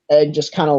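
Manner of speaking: ordinary talk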